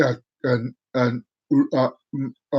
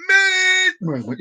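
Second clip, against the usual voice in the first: high, slow shouted announcement; slower than usual. Pretend. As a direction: call it out like a wild proclamation. Vlogger announces a mad moment.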